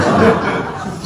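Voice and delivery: breathy laugh through the words; chuckling mid-sentence. Real, not acted. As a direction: laugh it out, amused at himself. Pastor laughs at missed amen.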